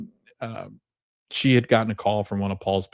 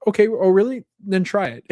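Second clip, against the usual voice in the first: high, sceptical mock dare; teasing challenge; 10 semitones higher than usual. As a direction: call the bluff in a high voice. Wife challenges husband's mustard claim.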